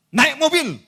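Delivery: high, loud proclamation; shouted declaration. Retold or imitated. Preacher declaring a car for motorcyclist, shouted proclamation. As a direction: burst out with it like a blessing. Re-enacts his declaration over member.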